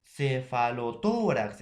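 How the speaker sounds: "Cefalotórax" slow, "tó" held long; stress on TÓ. Shown as a demonstration. Teacher showing the word is grave, slow stress on "tó" in "Cefalotórax". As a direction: draw out "tó" in "Cefalotórax". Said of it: "Tiene la mayor fuerza de voz".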